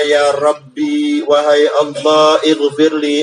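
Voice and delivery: drawn out, very slow plea to God; slow prayer. Acted. Servant praying to God for forgiveness, very slow and drawn out. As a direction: stretch each word like a humble prayer.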